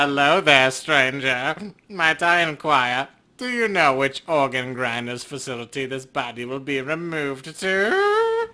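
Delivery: an unsettling undertaker elf who savours each word; creepy elf. Acted. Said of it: "that's good and creepy".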